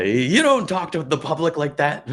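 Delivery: high, loud, slow mock parental scolding. Scolding the man in public, mock parent. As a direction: lecture him like a stern parent. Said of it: "continues parent voice".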